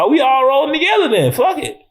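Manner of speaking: high, quick, carefree exclamation; playful enthusiasm. Retold or imitated. Acting out reaction to diverse cast.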